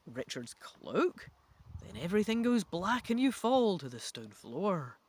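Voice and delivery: normal talking voice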